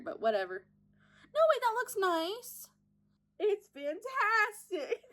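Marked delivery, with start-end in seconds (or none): 1.3-5.0 high drawn-out squeal of approval; over-the-top thrilled singsong; exaggerated delight. Real, not acted. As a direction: gush it out slowly in a high, silly voice. Mock-delighted outburst at own makeup.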